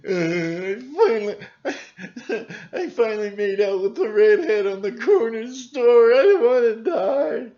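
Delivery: high whiny voice breaking into laughter; hammed-up scared kid laughing through it; mocking panicked youth. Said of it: "the youth".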